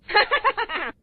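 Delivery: high bursting laugh. Real, not acted. Reader laughing at the joke, laugh. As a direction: break into a real laugh.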